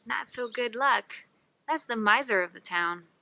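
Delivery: ordinary delivery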